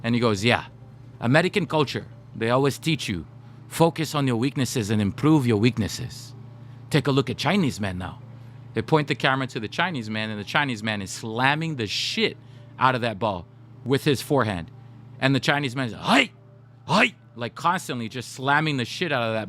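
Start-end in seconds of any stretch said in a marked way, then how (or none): 0.5-8.2 imitated Japanese accent, instructive. Coach explaining American weakness training, put-on Japanese accent. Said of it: "I'll do my Japanese accent". 15.9-17.1 short, high-pitched mock exertion cries; imitated hitting shouts. Imitating the Chinese player slamming the ball, short high cries.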